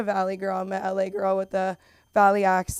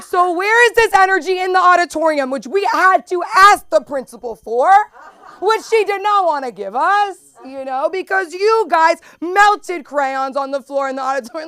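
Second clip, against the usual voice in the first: mock exasperated teacher; 8 semitones higher, 7 dB louder than usual. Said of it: "And I'm like".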